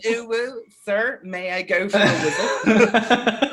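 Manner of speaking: high, slow, laughing little-pupil plea; mock pupil asking for the loo. Friend mocks the loo request as a pupil begging sir. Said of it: "I was such an odd way. It's like".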